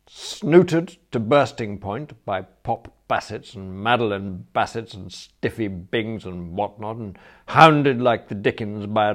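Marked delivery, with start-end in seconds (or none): none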